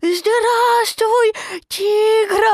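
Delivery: put-on very high, loud tiny-child voice; squeaky small kangaroo; little Roo. A narrator's reading. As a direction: greet excitedly in a high little voice. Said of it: "сказал крошка Ру".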